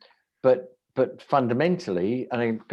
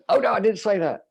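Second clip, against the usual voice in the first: mock retraction; 10 semitones higher, 5 dB louder than usual. Mock retraction after cheeky claim.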